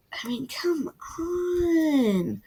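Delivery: high, drawn-out awed whisper; awed whisper over a favourite scene; breathless whisper. Real, not acted. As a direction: whisper it like you can't take how good it is. Gushing over the book's tension.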